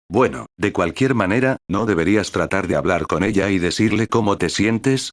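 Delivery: ordinary delivery, nothing marked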